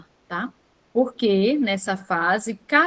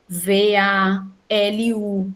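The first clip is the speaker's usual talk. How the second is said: "V A L U" drawn out letter by letter; slow spelling out; much slower than usual. Shown as a demonstration. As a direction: spell "V A L U" very slowly, in two pairs. Teacher spells out child's written syllables. ("V A L U" soletrado bem devagar)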